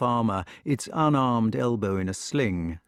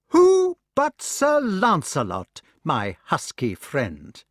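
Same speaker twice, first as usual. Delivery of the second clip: high, slow spiteful voice; sick son accusing with spite; spiteful blame; 6 semitones higher, 5 dB louder, slower than usual. Acted. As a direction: plant the name spitefully. Mordred blames Lancelot.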